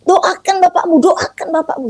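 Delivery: high, pressing voice of conscience; insistent inner voice. Retold or imitated. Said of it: "muncul lagi tuh di sua-, di hati nurani saya".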